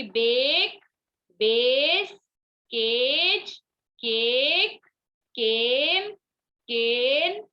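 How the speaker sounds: each word from बेक to फेस with the A held long, rising slightly; word list with stretched vowels, a pause after each; long A sound. Shown as a demonstration. Teacher reads examples so students hear it, long A in बेक, केज, फेस. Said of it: "मैंने long sound बोल रही हूँ".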